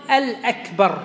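"الأكبر" with a clear "al" before "akbar"; إضافة ال التعريف. Shown as a demonstration. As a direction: put the article "al" distinctly in front, "al-akbar". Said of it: "قد يضيف ال التعريفية". ("الأكبر" بإظهار ال التعريف)

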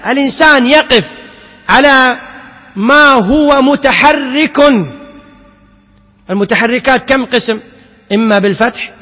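ordinary talk, nothing marked

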